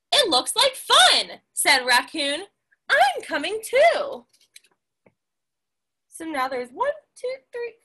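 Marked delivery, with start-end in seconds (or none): 0.1-1.4 high, eager raccoon voice, much higher; bubbly raccoon wants in; excited raccoon. Acted. 2.9-4.3 high, eager raccoon voice, much higher; bubbly raccoon wants in; excited raccoon. Acted.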